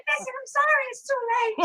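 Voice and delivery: very high comic falsetto; squeaky punchline voice. Acted. Doctor repeats bad news to husband, in squeaky falsetto.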